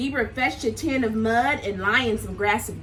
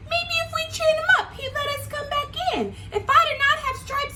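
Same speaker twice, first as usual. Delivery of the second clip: much higher, squeaky animal voice; Zebra voice; faster than usual. Acted. Zebra to the friends, high squeaky voice. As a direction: pipe up like a bright little zebra. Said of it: "said Zebra".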